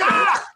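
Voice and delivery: short, high acted yelp; goblin's death cry. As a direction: cry out as if cleaved in half. Goblin cleaved in half.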